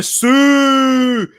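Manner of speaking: loud, high, long-held final word; celebration cry. Pretend. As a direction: call it out loud and stretch the last word.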